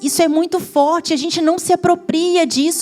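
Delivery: high, loud preaching at full force; impassioned sermon climax; fiery preaching. Acted. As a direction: preach it out, stirring the church.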